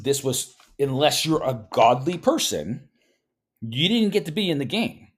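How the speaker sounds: usual speaking voice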